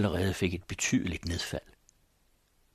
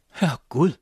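high, loud astonished exclamation; surprised cry; 7 semitones higher, 6 dB louder than usual. Acted. The old mother recognises the visitor, crying out in surprise. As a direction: gasp it out in amazement, clapping hands. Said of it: "råbte hun og slog hænderne sammen".

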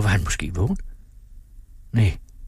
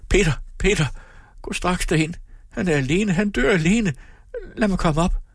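very high, frantic pleading; distraught cry; 13 semitones higher than usual. A narrator's reading. Sick woman begs Peter to go to him, distraught. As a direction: cry out as if confused and desperate. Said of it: "råbte forstyrret".

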